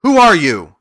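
loud, high challenge; crying out. A narrator's reading. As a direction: shout it as a challenge. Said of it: "rushed toward the little man and cried out".

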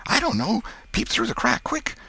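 very high, urgent fearful squeak; panicked boy. Acted. Tom begs Huck to peep outside, panicky. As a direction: urge your pal in a high panic.

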